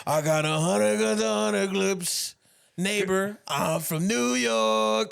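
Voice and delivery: loud, slow raspy rap. Retold or imitated. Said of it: "a [name] impression".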